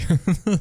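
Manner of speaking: sudden high burst of laughter. Retold or imitated. Stressed worker laughs at herself mid-sentence, a high burst. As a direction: laugh it off, flustered.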